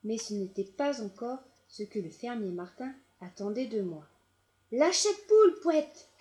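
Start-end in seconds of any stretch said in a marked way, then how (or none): 4.7-6.1 loud, high angry yell. A narrator's reading. Farmer yelling at the dog to drop the hen, loud. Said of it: "hurla-t-il".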